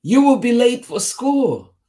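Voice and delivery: high, loud urgent call; mother crying out. A narrator's reading. Mommy rushing Tisha for school, high urgent cry. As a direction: cry out like a hurrying mom. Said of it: "cried Mommy".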